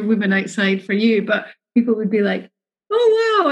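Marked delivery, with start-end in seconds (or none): 2.9-3.5 high, delighted gasp of admiration; admiring wow. Retold or imitated. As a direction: marvel at the babies, pitch way up. Passers-by admiring the twins.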